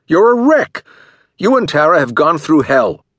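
high, loud, furious words; angry outburst. A narrator's reading. Jason rails at the detective about his wife, high and loud. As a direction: let the anger boil over. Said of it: "Anger had made him red-faced".

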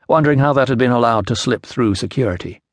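ordinary delivery, nothing marked

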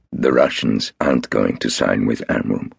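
flat certain denial; Golda, calm. A narrator's reading. Golda contradicting Marcus, low and calm.

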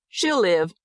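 lightly voiced doctor giving the news; doctor's pronouncement. A narrator's reading. Doctor telling worried parents the baby will live, reassuring. Doctor announces the child's survival.

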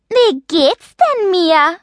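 high, slow, mocking lisp on "Wie geht's denn, Mia"; exaggerated lisp. A narrator's reading. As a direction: tease with "geht's" lisped, tongue between the teeth. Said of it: "mit einem unüberhörbaren Lispeln".

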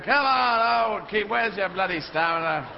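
loud granny hollering at a straggler; scolding granny. Acted. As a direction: holler impatiently, stretching the opening call. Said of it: "granny going".

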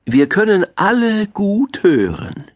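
high, emphatic teacherly voice; wise owl. A narrator's reading. Owl explains what all animals do well, high teacherly tone. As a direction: spell out the answer for the class. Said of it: "sagte die kluge Eule lächelnd".

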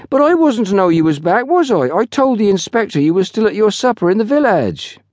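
put-on high, aggrieved young porter; resentful. A narrator's reading. Porter defends himself to his boss, resentful. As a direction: protest in an indignant lad's voice. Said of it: "the decided resentment with which it had been delivered".

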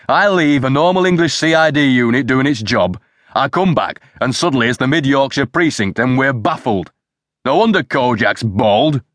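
high-pitched, indignant tirade; angry rant. A narrator's reading. Superintendent berates his men over the newspaper, ranting angrily. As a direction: rant in exasperation, voice pitched well up.

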